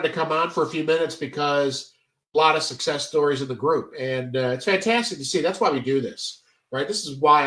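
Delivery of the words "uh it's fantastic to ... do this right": ordinary talk, nothing marked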